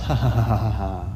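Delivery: hammed-up villain cackle, fast; evil laugh. Villain interrupting the women's meeting with an evil cackle. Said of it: "an evil laugh".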